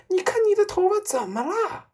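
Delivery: very high, loud scream rising at the end; horrified shriek. A narrator's reading. Mother screams at her husband about his hair, shrieking. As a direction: shriek the question in panic. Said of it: "妈妈用发着抖的手指指着她丈夫尖 声叫道".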